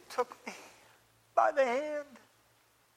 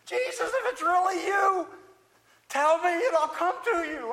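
higher and louder burst of bold belief; surge of faith; 7 semitones higher, 6 dB louder than usual. Acted. Peter calls to Jesus from the boat, surging with belief. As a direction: call out to Jesus in a sudden surge. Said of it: "surge of something came up in me".

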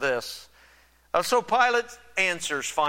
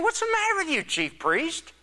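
higher, exasperated Pilate voice; higher, quicker exasperated governor; 8 semitones higher than usual. Retold or imitated. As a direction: play Pilate fed up with the priests. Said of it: "He's saying".

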